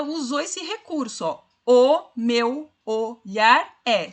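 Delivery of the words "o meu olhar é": very slow, each syllable spaced out; slow syllable scansion. Shown as a demonstration. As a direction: tap out the five syllables. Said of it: "cinco versos, cinco sílabas".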